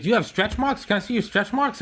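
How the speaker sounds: high, eager prying question; mock curious friend. Med student friend prying about stretch marks, high and eager. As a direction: ask high and nosy. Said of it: "he's like".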